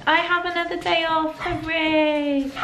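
high, lilting little cheer; sing-song celebration. Real, not acted. Vlogger announcing a day off, sing-song celebration. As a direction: sing out the good news lightly.

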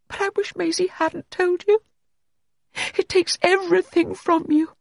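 very high, thin, unearthly tone; haunted woman, pitched far up; eerie high voice. A narrator's reading. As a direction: hold it high on a monotone. Allegra after revealing her madness.

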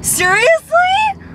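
high, slow gasping seriously; hearing she got a car, high slow stunned seriously; stunned disbelief. Real, not acted. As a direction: drag it out in shock, way up high. Sister hears she got car.

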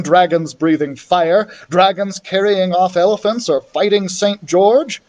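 usual speaking voice

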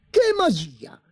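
high, eager burst; can't contain the excitement; excited cry. A narrator's reading. Emperor crying out excitedly to the tailors. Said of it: "gridò eccitato".

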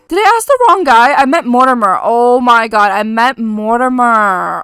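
loud yelp at own mistake; exasperated outburst. Real, not acted. Game player realises she asked the wrong guy, exasperated outburst.

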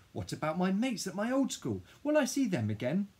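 high, insistent questioning; upset protest. A narrator's reading. Child asking Dad about old mates, high upset protest. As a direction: plead indignantly, pitched high.

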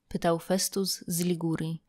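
plain reading voice

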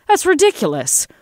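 very high, loud protest; indignant outburst. A narrator's reading. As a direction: burst out in disbelief at the officer. Senator scoffs at cop's warning.

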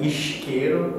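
isqueiro with a Rio sh, eesh-keiro; Rio accent. Shown as a demonstration. Explaining Brazilian accents, Rio pronunciation of isqueiro. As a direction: Carioca isqueiro, s turned into sh. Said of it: "they say, like".

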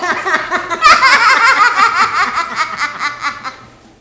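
big burst of laughter; loud laugh. Real, not acted. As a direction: crack up loudly. Burst of laughter at own joke.

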